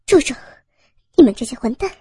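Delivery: loud angry outcry; struggling protest. Acted. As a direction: shout at the captors while struggling. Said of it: "赵建岭挣扎着说道". (挣扎着怒喊)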